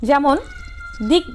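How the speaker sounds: usual speaking voice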